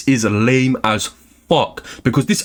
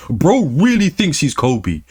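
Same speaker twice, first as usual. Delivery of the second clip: ordinary talk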